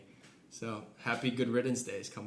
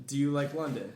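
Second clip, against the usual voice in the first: ordinary delivery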